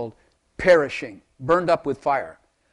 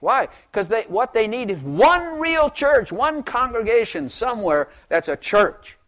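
fervent; 7 semitones higher, 4 dB louder than usual. Real, not acted. Preacher stressing the need for one church, fervent high delivery. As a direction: lift the voice and stretch it.

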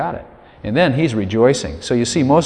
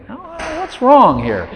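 puzzled self-talk; 10 semitones higher, slower than usual. Retold or imitated. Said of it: "You think".

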